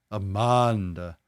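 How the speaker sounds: Amanda slow, with a long "ah"; exaggerated open A in Amanda; accented A. Shown as a demonstration. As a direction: stretch Amanda out as "ah-MAAHN-da". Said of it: "or an accent over your A?".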